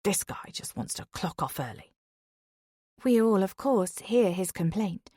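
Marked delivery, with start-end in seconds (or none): 0.0-1.9 a softer, lower stage whisper meant to be overheard; loud whisper. Acted. Husband grumbling about the driver to Dawn, loud whisper. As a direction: mutter it under your breath, but audibly. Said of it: "in a loud whisper".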